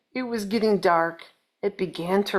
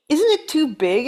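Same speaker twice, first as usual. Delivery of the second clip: raised, louder bird voice; Mister Bird; 6 semitones higher, 6 dB louder than usual. Acted. Mister Bird doubts the big place, raised voice. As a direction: ask it doubtfully as the small bird. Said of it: "asked Mister Bird".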